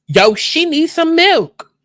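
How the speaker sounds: high, slow put-on voice; mock voice.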